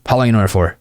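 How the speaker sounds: uninflected, rote question; dull, linear interviewer delivery; boring interview mode. Shown as a demonstration. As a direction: ask like ticking off a list. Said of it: "very linear and gross and just boring".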